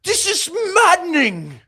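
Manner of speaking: high, loud British outrage, drawn out; hammed-up exasperated British shriek; John Oliver. Podcaster mocking a TV host's rants, loud high mock outrage. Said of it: "when he goes like that".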